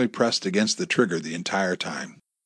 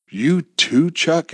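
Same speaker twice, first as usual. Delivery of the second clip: shocked; 8 semitones higher, 5 dB louder, much slower than usual. A narrator's reading. Amy shocked at betrayal.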